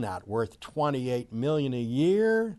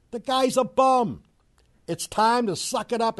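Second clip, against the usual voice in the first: angry rant; 6 semitones higher than usual. Real, not acted. Ranting at A-Rod.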